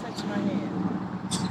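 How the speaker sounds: slow soft plea; soft slow coax. Real, not acted. Coaxing the tree, slow soft plea. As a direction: murmur it slowly.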